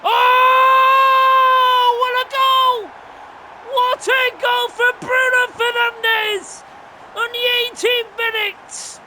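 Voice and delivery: high, loud, drawn-out goal shout; goal celebration. Real, not acted. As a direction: roar it like a commentator after a screamer.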